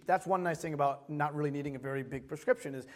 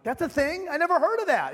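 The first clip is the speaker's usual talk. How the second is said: higher and louder, amazed; surprised disbelief; 9 semitones higher, 5 dB louder than usual. Retold or imitated. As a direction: react like it's news to you. Shocked by the eye doctor's news.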